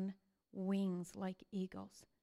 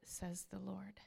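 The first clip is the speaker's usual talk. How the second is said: whispered; 2 semitones lower, 14 dB quieter than usual. Real, not acted. Preacher closing a verse, in a whisper. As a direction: drop to a breathy whisper. Ending a scripture quote.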